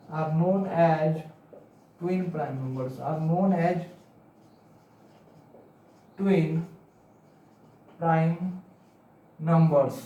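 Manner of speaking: slow, word by word, for the class to write down; slow dictation. Shown as a demonstration. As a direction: dictate each phrase slowly and repeat it. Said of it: "तो लिखिएगा". (बच्चों को लिखवाते हुए धीरे-धीरे बोलना)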